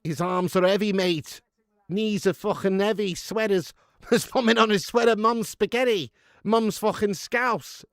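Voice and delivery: Scouse [name]. Pretend. Streamer spoofing [name] for viewers, hammed-up Scouse. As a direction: punch out the lyrics in broad Scouse. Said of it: "Do some [name], but in Scouse".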